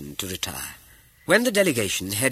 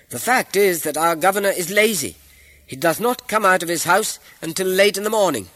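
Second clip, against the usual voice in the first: high, loud public address; bold loud address; 10 semitones higher, 8 dB louder than usual. A narrator's reading. Young accuser addresses the caliph before the crowd, bold loud call. Accuser airs complaint loudly.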